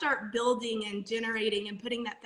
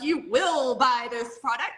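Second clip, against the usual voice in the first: slow, louder command; forceful mock demand; 6 dB louder, slower than usual. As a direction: act out a bullying salesperson. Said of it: "with a club being like".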